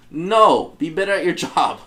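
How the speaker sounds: sassy comeback aimed at the officer; mock retort. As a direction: tell him off, half joking. Retort to cop, retold.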